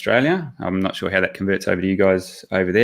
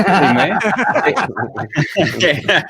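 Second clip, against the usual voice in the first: higher, laughing through the words; laughing reply; 7 semitones higher, 4 dB louder, much slower than usual. Real, not acted. Guest joking about his accent, laughing. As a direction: answer while cracking up.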